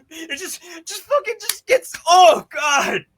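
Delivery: high, worked-up sputtering; overwhelmed exclamation. Real, not acted. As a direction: stumble over the words, pitch shooting up. Overwhelmed while recounting the loss.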